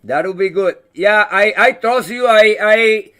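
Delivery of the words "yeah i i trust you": ordinary talk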